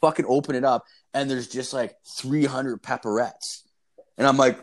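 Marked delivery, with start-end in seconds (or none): none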